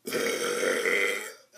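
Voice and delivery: long low forced burp; big rumbling burp; loud burp. Real, not acted. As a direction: belch it out deep.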